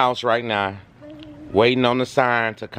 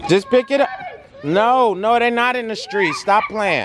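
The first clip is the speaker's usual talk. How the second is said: raised, higher call across the yard; 9 semitones higher than usual. Real, not acted. Dad calling out to kids across the yard, raised and higher.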